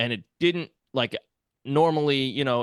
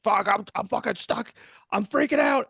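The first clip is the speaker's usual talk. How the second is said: high, strained panic, pitched way up; a trapped man losing it, much higher; panicked; 9 semitones higher than usual. Retold or imitated. Stuck crawling in the cave, panicking to the pig, high and strained.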